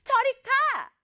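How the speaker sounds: very high-pitched cross tantrum; mock angry dismissal. Teacher acts the sister at her bedroom door, mock angry dismissal. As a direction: order them away in a high, cross voice. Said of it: "지율이가 화가 많이 났어요".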